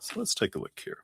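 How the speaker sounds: whispered aside while shuffling; under-breath whisper. Real, not acted. Shuffling the deck for the viewer, whispering to herself. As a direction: breathe the words, almost silent.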